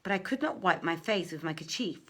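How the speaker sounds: ordinary delivery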